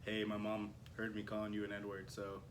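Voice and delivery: soft, low mock-sheepish apology; imitated embarrassed confession, trailing off; sheepish kid imitation. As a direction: mumble a reluctant apology, imitating a caught kid. Retold sheepish apology message from kid.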